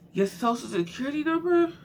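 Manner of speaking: can't believe people did that; incredulous. Real, not acted. Viewer reacting to numbers on bicycles, loudly incredulous. Disbelief at the idea.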